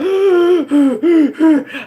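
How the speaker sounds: loud high gasp; mock panicked gasp. Vlogger gasps, acting out her corona scare.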